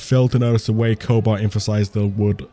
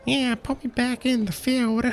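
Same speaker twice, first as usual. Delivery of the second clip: very high, soft squeaky voice; mock little falsetto plea; meek servile woman; 6 dB quieter than usual. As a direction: put on a tiny high-pitched voice, much quieter. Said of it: "even though she's just like".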